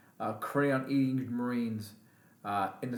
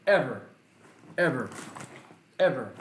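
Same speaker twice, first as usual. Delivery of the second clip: each "ever" punched louder with a falling end; angry repeated stress; 4 dB louder than usual. Real, not acted. Veteran telling viewers his shame at serving, each "ever" hit hard. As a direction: hammer every "ever" out, separated by pauses.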